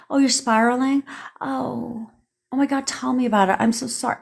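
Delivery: high, sugary fake sympathy, hammed up; mock the soothing friend she refuses to be; mock coddling. Mocking followers who want coddling over text, high fake sympathy. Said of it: "if you think I'm gonna coddle your ass".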